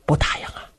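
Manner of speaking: breathy conspiratorial whisper. Acted. Du Cha whispers his scheme to Luo Cheng, hushed. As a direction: lean in and whisper it like a secret plot.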